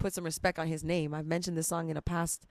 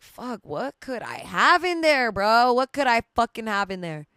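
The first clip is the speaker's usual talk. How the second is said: exasperated outburst; 5 semitones higher, 6 dB louder than usual. Retold or imitated. Telling how strangers kept sending her back inside, exasperated outburst. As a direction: exclaim it in acted exasperation.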